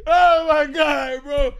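slow, loud, drawn-out cry; exasperated shout. Real, not acted. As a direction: stretch it out in loud disbelief. Shouting through laughter.

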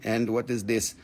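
normal talking voice

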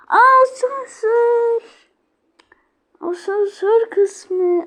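high, loud, drawn-out slow exclamation; slow sing-song surprise at spotting something; surprised discovery. Real, not acted. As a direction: stretch it out in delighted surprise. Spots the elevator in game.

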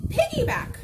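high, loud cheer on piggyback; gleeful high call; excited exclaim. Acted. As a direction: shout it out with glee. Exclaiming in story reading.

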